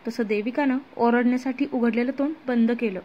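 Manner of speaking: usual speaking voice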